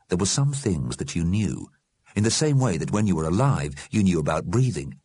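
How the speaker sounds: plain reading voice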